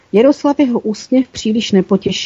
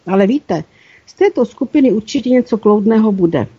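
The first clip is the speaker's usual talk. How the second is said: usual speaking voice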